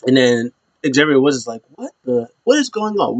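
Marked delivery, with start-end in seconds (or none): 1.7-3.2 high-pitched mock bewilderment; baffled disbelief. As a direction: imitate a wrestler caught off guard, voice higher. Woods baffled at surprise arrival.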